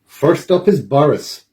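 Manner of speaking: plain reading voice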